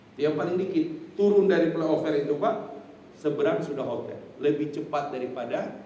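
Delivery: ordinary talk, nothing marked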